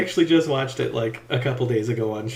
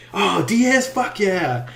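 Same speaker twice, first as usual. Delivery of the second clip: higher, in a changed gleeful voice; excited cheer; 6 semitones higher, much slower than usual. Retold or imitated. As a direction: celebrate seeing the schedule. Cheering seeing favorite partner.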